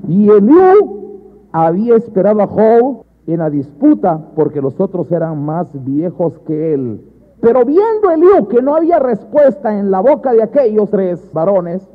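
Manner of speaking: loud, slow, drawn-out proclamation; emphatic scripture reading. Acted. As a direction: declaim the verse to the congregation.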